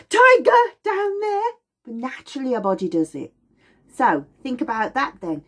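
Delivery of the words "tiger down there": high, loud panicked cry; hammed-up terror; mock alarm. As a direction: shout the warning in a panic. Alarm at tiger.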